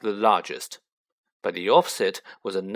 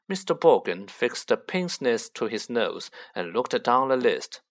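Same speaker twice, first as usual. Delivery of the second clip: ordinary delivery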